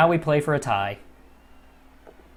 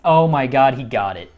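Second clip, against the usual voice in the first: ordinary delivery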